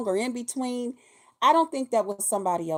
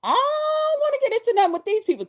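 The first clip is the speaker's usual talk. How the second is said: high, put-on shocked voice, much higher; hammed-up alarm at a hot panel; mock startled; 12 semitones higher, 3 dB louder than usual. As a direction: squeal it like you're scared of the panel. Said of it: "I was like".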